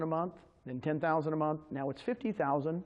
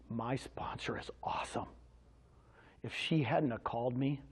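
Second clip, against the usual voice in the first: tearful quote; 6 semitones lower, 6 dB quieter than usual. Retold or imitated. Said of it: "He started to cry when he mentioned Sheila".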